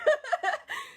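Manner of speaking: squealing high laugh; high giggle. Retold or imitated. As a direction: let out a giddy shriek of laughter. Grandma laugh after line.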